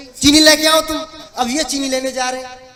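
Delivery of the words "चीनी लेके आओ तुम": mock wifely command, higher and louder; wife's order. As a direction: order the husband off on an errand. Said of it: "इनकी पत्नी जी ने अपने पास बुलाया". (पत्नी के हुक्म की हल्की नकल)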